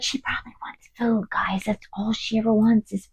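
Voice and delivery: whispered aside; whispering. Real, not acted. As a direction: drop to a whisper about the dog. Aside about dog wanting food.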